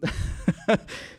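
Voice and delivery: short breathy giggle; amused chuckle. Real, not acted. Host after his accent bit, amused chuckle. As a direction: laugh at your own silly bit.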